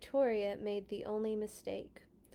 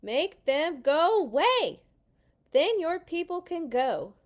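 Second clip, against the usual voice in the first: higher, louder, slow desperate cry; Pharaoh screaming; 7 semitones higher, 10 dB louder than usual. Acted. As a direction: beg loudly to end the frogs. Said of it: "Pharaoh screamed".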